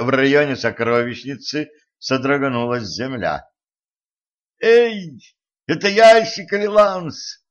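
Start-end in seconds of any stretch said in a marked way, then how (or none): 4.6-7.4 loud, high-pitched yell. A narrator's reading. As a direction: yell the question through to those beyond. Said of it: "завопила Катран". (громкий вопль)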